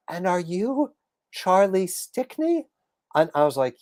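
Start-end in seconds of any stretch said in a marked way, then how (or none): 0.1-2.7 high, slow, wondering question; imitating a starstruck older fan; awestruck fan. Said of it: "she goes".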